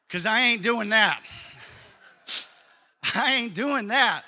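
loud, stubborn refusal, ain't leaned on; flat refusal. Pretend. Preacher voicing the wife's refusal, loud and stubborn. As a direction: plant your feet and refuse it out loud.

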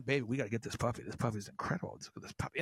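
low, fast, fading mock husband; hurried husband trailing to a hush; pleading husband. Imitating the husband urging his wife, low and fading to a hush. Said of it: "or the guy's going".

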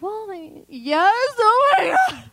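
high wail bursting out of a small voice; mock crying outburst. Client admitting disappointment to her counselor, mock wailing breakdown. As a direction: break into a hammed-up loud, high sob. I cried for an hour.

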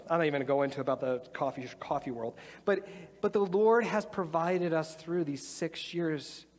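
ordinary talk

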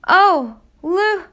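high, loud gasping cry of his name; overwhelmed at seeing her son; mother stunned by her son's return; astonished mother. Acted.

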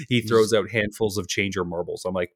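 normal talking voice

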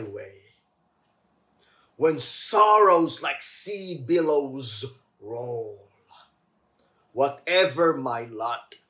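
slow, swelling hymn lines; hymn recitation. Acted. Pastor reciting the hymn to the church, reverent. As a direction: read the hymn with feeling. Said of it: "basahin ko sa inyo yung lyrics".

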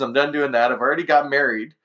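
usual speaking voice

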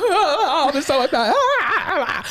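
mock crying. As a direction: whimper it while laughing. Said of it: "the patient just cries".

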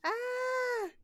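high startled yelp; mock scream. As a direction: squeal in mock fright. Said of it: "that's exactly how I'm gonna react".

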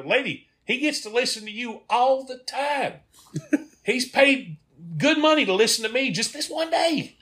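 very high, indignant comic appeal; exasperated mock scolding. Tour guide to a wife talking through the tour, exasperated. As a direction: plead your case in high-pitched exasperation.

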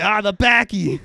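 very loud, high mock Boston accent; Boston manager shouting. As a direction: holler it like an exasperated Boston local. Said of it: "he was from Boston".

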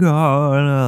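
loud, high oh no; freaking out. Retold or imitated. Kid freaking out after twenty minutes lost, loud. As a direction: blurt it out in panic. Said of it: "freaking out".

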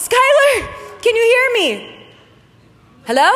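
calling out loud and high; calling a friend's name into a cave; yelling. Retold or imitated. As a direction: shout down into the cave.

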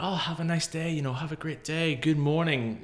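high-pitched mock coffee shop chirp; over-positive American greeting. New York coffee shop staff greeting customers, chirpily positive. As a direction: imitate a relentlessly positive server. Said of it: "they're so positive".

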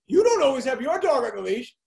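the neighbour scolding him in a mock high voice; mock scolding lady. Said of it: "She goes".

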